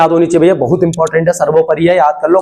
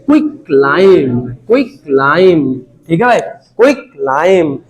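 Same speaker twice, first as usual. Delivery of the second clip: "Quick lime" drawn out slowly, a bit lower; slow drilled answer; 3 semitones lower, much slower than usual. Shown as a demonstration. Teacher drills the name to students, slow and low. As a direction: spell it out slowly so it sticks. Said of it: "क्या नाम था इसका?".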